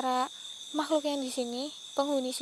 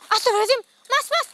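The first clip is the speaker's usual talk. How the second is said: loud, much higher panicked cry; frightened cry; 9 semitones higher, 11 dB louder, faster than usual. Real, not acted. Panicked ghost hunter calling the cameraman, loud. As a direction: cry out as she appears. Spirit suddenly appears.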